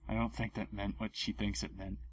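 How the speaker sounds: soft, fast muttered aside. Real, not acted. Reader mutters about the author's misused word, very soft and fast. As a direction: throw it away under your breath.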